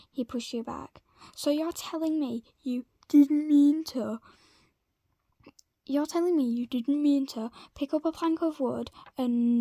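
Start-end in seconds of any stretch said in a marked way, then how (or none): 1.4-9.6 angry. Acted. Angry confrontation with Billy.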